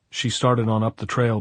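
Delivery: plain reading voice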